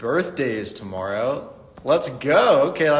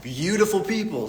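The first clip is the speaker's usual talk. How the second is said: slow, sing-song hello, pitched up; playful greeting; 4 semitones higher, slower than usual. Real, not acted. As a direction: stretch it out like a goofy rhyme to open the show. Playful stream opening greeting.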